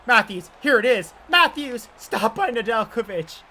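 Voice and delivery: a hint of a broadcast legend's excited call; Chris Cuthbert call. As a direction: build it like a TV play-by-play man at the big chance. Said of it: "my best Chris Cuthbert impression".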